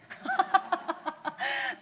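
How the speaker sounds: real amused laugh. Real, not acted. Pastor whose joke about looking gentle fell flat, laughing. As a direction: laugh it off at your own expense.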